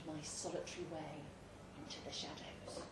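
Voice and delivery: dropped to a breathy whisper; a spooky storyteller's whisper; hushed. A narrator's reading. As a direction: whisper it as if the dark might hear. Walking alone into dark place.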